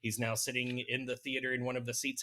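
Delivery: ordinary talk, nothing marked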